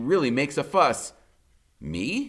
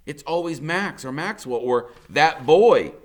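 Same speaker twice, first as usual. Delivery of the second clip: ordinary delivery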